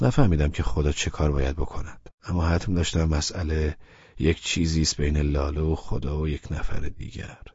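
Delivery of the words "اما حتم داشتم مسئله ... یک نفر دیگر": ordinary delivery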